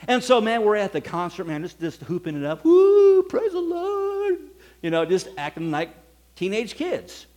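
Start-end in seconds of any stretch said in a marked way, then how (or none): 2.6-4.4 high, loud whoop then a slow drawn cheer; hollering like a hyped teenager at a rock show; rowdy teen cheer. Retold or imitated. Teenagers hooping it up at concert.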